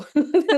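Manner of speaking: real embarrassed laugh; loud short laugh. Real, not acted. Laughs after "não deu.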